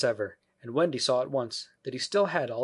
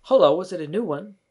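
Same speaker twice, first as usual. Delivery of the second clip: put-on high, loud boyish exclamation; curious boy; 8 semitones higher, 9 dB louder than usual. A narrator's reading. Peter discovers a new child, loud high boy voice. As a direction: ask brightly loud, as a boy.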